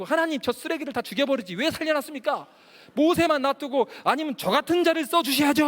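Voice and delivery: 높고 크게 소리치는 항변; 하나님께 따지며 분을 터뜨리기; 분노한 항의. Retold or imitated. 하나님께 분노를 터뜨림. (성경을 던지며 하나님께 항의하는 분노의 외침)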